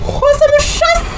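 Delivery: high, slow panicked scream; panicked shriek. Real, not acted. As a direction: shriek in fear as it chases. Panicked gamer screams at mob.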